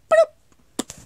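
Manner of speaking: high, loud little plop noise; imitated falling noise. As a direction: make a sudden cartoon tumble sound.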